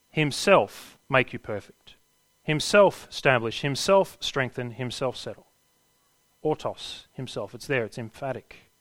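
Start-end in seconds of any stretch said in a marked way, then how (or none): 0.1-7.5 himself struck hard each time, then fading; stress on himself. Shown as a demonstration. Preacher stressing himself to the congregation, emphatic then softening. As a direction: lean on himself, letting it sink. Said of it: "it is emphatic in the Greek".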